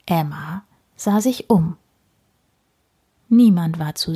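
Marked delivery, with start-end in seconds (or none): none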